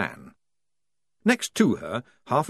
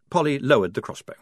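ordinary delivery

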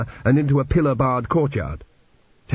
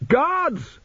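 screamed. A narrator's reading. Prisoner betrays his would-be rescuer, high contained scream. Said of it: "he screamed".

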